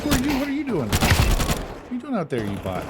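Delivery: what are you even doing, cried high; incredulous high yell. Real, not acted. Gamer yelling at a bot teammate, incredulous high yell. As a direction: pitch shooting way up in disbelief.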